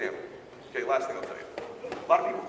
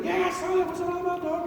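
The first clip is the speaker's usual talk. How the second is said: a friend's voice; 11 semitones higher than usual. Retold or imitated. Recruit's friend voiced in the phone call.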